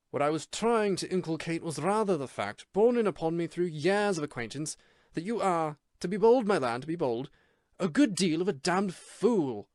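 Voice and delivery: put-on light Irish accent, slowing at the end; Irish trilled R's. A narrator's reading. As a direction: roll the R's Irish style. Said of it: "The trilling of his R's".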